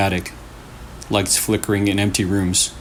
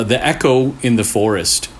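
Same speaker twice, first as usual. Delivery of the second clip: usual speaking voice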